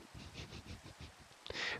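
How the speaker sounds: long voiceless chuckle; whispered laugh. Real, not acted. Player amused the house burnt down, whispered laugh. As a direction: laugh silently on the breath.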